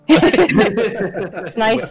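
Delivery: slow greeting broken by real laughter; can't keep a straight face saying it; laughing through it. Real, not acted. Player greets Mintz while cracking up, laughing.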